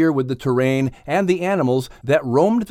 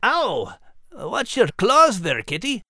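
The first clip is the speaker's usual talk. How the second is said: high, cheery Scottish brogue; lilting Scottish accent, pitched high; Scottish terrier voice; 6 semitones higher, slower than usual. Acted. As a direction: play a proud little Scottish dog. Max voice held.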